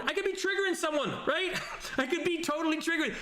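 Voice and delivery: high, laughing self-mockery; giggling while joking he offends. Real, not acted. Streamer jokes about triggering chat, laughing high.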